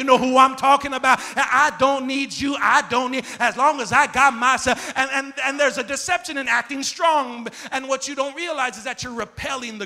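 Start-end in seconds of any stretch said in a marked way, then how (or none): 1.4-4.9 sassy. Retold or imitated. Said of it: "your neck starts moving a certain way".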